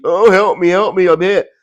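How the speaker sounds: loud, high-pitched wail of alarm; imitated cry for help. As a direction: mimic the passenger's panicked pleading. Acts out wounded passenger's plea.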